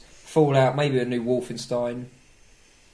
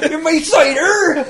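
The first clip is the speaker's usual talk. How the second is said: much higher, slow laughing yokel; mock West Country; 10 dB louder, slower than usual. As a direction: squeak it as a silly cider farmer.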